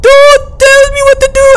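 very high, loud held wail. Pretend. Stuck player refusing help, shrill theatrical wail. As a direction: cry it out theatrically at the top of the voice.

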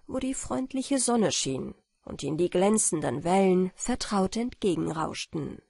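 plain reading voice

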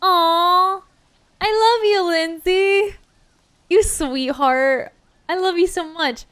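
high, doting coo; gushing. Real, not acted. Streamer cooing over a friend's sweet song gift, doting.

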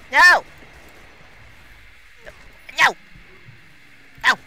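very loud high no; desperate no. Real, not acted. Gamer losing a fight, loud desperate cry. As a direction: scream it in despair.

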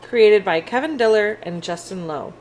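ordinary delivery

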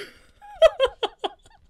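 loud, high delighted laugh; real delighted laugh. Real, not acted. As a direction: laugh out at the line. Laughs at the story line.

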